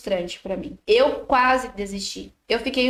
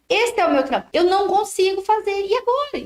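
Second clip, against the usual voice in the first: growing despair; 8 semitones higher than usual. Retold or imitated. Artisan retells her panic after the client rejected the piece, pitch climbing higher. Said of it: "Eu já comecei a ficar desesperada".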